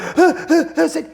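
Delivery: high, sharp gasp with a laugh in it; mocking gasp. Mocking how most people react to a ghost, gasp. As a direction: imitate people gasping in fright. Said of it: "Most people are like".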